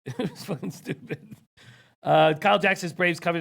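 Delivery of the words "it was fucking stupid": laughing. Real, not acted. Laughing at own mistake.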